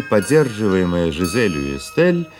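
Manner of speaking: ordinary talk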